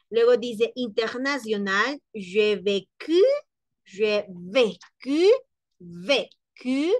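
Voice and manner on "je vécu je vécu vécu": "je vécu" drawn out slowly, rising at the end; slow pronunciation demo. Shown as a demonstration. Teacher drilling intonation and accentuation, slow pronunciation demo. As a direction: sound out "vécu" slowly in two clear syllables. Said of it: "entonación y pronunciación, acentuación".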